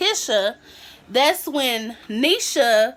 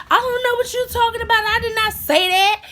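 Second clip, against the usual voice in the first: high-pitched imitation of a classmate denying it; mock denial; 10 semitones higher, faster than usual. Classmate denies blame to his accuser, high mock denial. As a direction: rattle off a squeaky, hurried protest of innocence.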